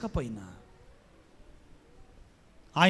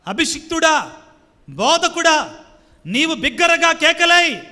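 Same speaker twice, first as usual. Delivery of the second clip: booming preacher's call, louder and higher; ringing pulpit shout; loud proclaiming; 5 semitones higher, 7 dB louder, slower than usual. Acted. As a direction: cry it out like a trumpet to the prophet. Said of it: "ఎందుకు అంత గట్టిగా చెప్తారు".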